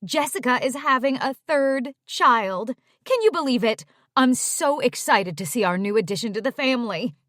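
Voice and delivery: gushing. Acted. Mom raving about her third grandchild, gushing. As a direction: pour out the excitement. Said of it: "Mom gushed on".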